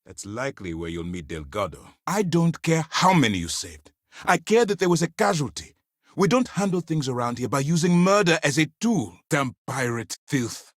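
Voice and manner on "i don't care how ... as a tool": high, forceful scolding; outraged commander, voice pitched up; angry rebuke. Acted. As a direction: lay down the law to a subordinate. Officer rebukes agent over casualty.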